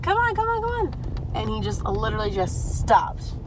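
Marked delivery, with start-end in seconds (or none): 0.0-0.9 high, loud call to a dog; mock call to a runaway pet. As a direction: coax the little dog over, calling high. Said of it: "She was like".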